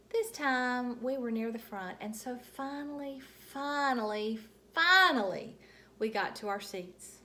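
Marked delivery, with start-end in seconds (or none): 2.0-5.6 each finally louder and higher; building finally. A narrator's reading. Reading to kids, finally building to a big cry. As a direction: stretch the finallys into a big payoff.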